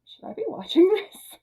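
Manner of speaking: put-on high, startled kid asking if it's allowed; shocked kid. Child watching an awkward movie scene, high startled squeak. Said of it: "I'm just like".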